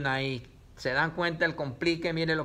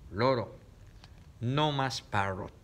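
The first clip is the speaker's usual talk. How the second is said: normal talking voice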